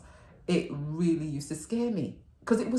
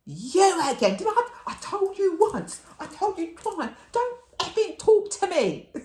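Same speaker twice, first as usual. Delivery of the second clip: much higher, louder, exasperated scolding; mock angry grump; 6 dB louder than usual. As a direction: tell her off one more time. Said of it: "He was like".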